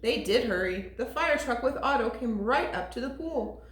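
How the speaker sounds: ordinary delivery, nothing marked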